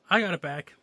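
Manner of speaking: put-on boy calling to the woman; called out. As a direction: call back across the crowd. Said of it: "He called".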